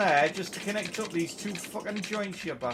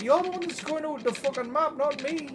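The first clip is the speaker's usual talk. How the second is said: indignant retort; 7 semitones higher, 3 dB louder than usual. Real, not acted. Arguing back at the game.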